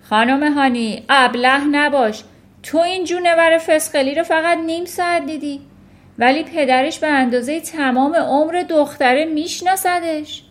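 loud, domineering put-down; scornful headmistress. A narrator's reading. Headmistress telling teacher not to be foolish, scornful. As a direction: scold her loudly from above.

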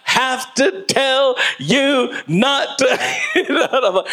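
slow, high mock-scolding mother voice. Mother scolding her child, slow, high and exasperated. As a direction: drag out the scolding like an exasperated mom.

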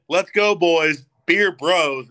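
frat bro cheer. Retold or imitated. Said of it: "I said".

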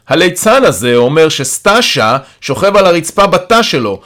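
scornful calling. A narrator's reading. Carer tells Yaron what the clown claims, scornful. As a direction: report it loudly with derision. Mocking report called across to Yaron.

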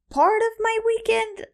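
incredulous high squeak; baffled pitch jump; surprised. Real, not acted. Question read back in disbelief.

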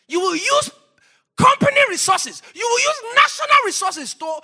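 shouted, high-pitched warning; full-force preaching. Real, not acted. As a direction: build to a high, loud peak.

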